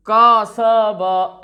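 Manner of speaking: कसब dragged out as kaa-saa-baa; very slow, drawn-out कसब; wrongly stretched vowels. Shown as a demonstration. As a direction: hold every vowel of कसब very long. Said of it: "ऐसे नहीं पढ़ेंगे।".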